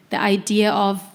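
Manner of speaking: usual speaking voice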